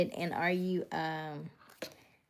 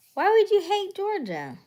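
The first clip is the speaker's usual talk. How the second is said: much higher and louder disbelieving question; surprised outrage; 13 semitones higher, 8 dB louder than usual. Real, not acted. Answering a viewer who hates Georgia, high and incredulous. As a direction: react like you can't believe it.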